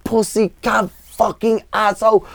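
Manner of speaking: high, strained cursing fit, imitating an enraged singer; retold furious tirade. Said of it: "comes storming into that club".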